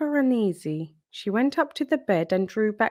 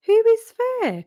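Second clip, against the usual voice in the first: a lightly voiced frail grandmother calling out; grandmother's call; 7 dB louder than usual. A narrator's reading. Grandmother calls to the knock at her door, high voice. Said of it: "replied the wolf in a high voice".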